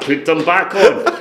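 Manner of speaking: high, slow laughing plea; mock-appalled laughing plea; horrified laugh. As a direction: beg through laughter. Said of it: "Gene's gone".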